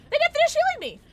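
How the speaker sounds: loud, squealed complaint; high-pitched protest. Real, not acted. Player left half healed, high-pitched protest. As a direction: shriek the grievance.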